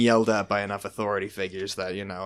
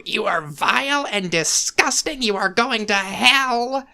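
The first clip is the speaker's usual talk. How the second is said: slow, high preachy scolding; shaming churchgoer; 11 semitones higher, 4 dB louder, slower than usual. Retold or imitated. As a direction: condemn like a church lady. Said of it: "if she's shaming me".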